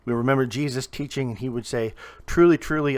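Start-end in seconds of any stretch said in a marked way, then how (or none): 2.3-3.0 "Truly, truly" hit high and loud; emphatic repetition. Retold or imitated. Preacher quoting Jesus's teaching, emphatic repetition. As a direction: punch both words of "Truly, truly". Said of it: "it's for emphasis".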